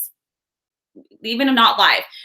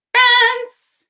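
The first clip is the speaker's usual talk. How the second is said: excited exclaim; 12 semitones higher, 5 dB louder than usual. Real, not acted. Reading a viewer's location.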